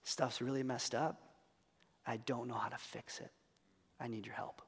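very soft, low, halting prayer; small, low-voiced confession; hushed plea. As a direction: plead quietly for help, voice dropped low.

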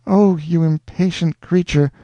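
high, rapturous heroine; cling to him with joy; Rosanna, rapturous. A narrator's reading. Rosanna begging Alonzo to stay, rapturous.

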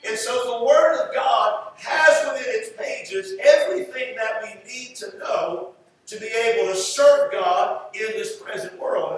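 ordinary delivery

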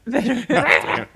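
laughing. Real, not acted. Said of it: "I don't sense any bitter".

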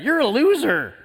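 high-pitched parrot taunt; heckling parrot. Acted. Parrot jeers every time the customer walks by, high mocking taunt. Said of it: "Every time he walked by this parrot".

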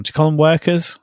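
ordinary talk, nothing marked

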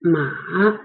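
ma sinking then turning upward; dipping third tone. Shown as a demonstration. Teacher pronouncing horse in Chinese, dip and rise. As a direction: dip ma low before raising it. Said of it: "lower it and rise it".

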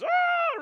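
very high falling cry; falling scream. Acted. Satan tumbling into the pit, very high yelp. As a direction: yelp as you drop into a pit.

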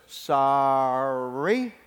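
drawn-out, sarcastic sorry with a rising end; insincere apology. Acted. Boy made to repeat his apology, mock-sorry. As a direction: drag the word out, plainly not meaning it. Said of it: "He doesn't".

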